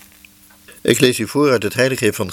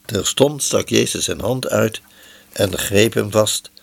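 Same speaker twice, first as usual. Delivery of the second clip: ordinary delivery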